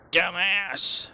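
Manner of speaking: angry at his own mistake; sharp self-directed shout; self-scolding shout. Real, not acted. Self-scolding after game loss.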